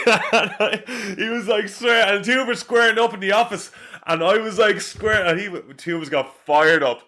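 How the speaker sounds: high, laughing retelling of the fight; telling it through laughter, pitch way up. Real, not acted. Laughing while recalling the fight.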